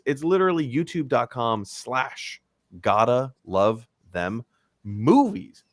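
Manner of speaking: ordinary talk, nothing marked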